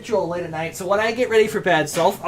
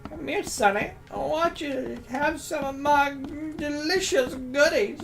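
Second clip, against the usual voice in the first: put-on high, slow sweet old granny; 5 semitones higher, 3 dB quieter, slower than usual. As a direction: coax like a creepy old granny. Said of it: "my old granny voice".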